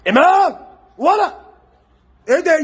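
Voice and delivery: loud, high cry of amazement; excited shout. Real, not acted. Vlogger cheers Imam's assist, loud and high. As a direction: yell his name in delight.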